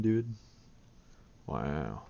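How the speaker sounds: ordinary talk, nothing marked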